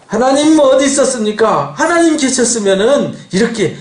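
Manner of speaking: high-pitched complaint to God; acted-out aggrieved questioning; indignant protest. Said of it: "라고 이제 항의를 하는 거예요".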